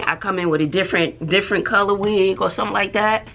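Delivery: ordinary talk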